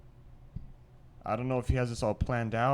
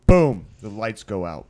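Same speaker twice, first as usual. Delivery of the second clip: dramatic boom; 2 semitones lower, 10 dB louder than usual. Acted. Dramatic story beat.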